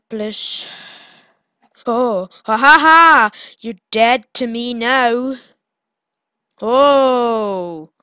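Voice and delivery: normal talking voice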